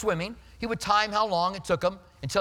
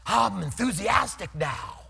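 slow, sarcastic shouted mock cheer; drawn-out sarcastic "now"; mock enthusiasm; much slower than usual. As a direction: parody a believer faking excitement. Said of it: "And people go".

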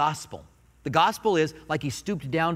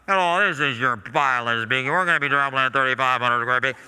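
airline pilot voice; 5 semitones lower than usual. Retold or imitated. Pilot over the intercom to passengers, lower put-on captain voice. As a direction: do the stock captain announcement voice. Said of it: "all pilots sound the same".

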